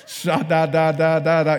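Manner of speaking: loud, chanted run of syllables; mock tongues. Preacher imitating a beginner praying in tongues, mock fervour. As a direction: pound out the nonsense syllables loudly.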